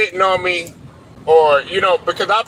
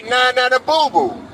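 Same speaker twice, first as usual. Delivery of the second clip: gloating taunt; 2 semitones higher than usual. Pretend. Taunting the rival team after a winning season, singsong and gloating. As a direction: rub it in like a playground taunt. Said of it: "your opportunity to say".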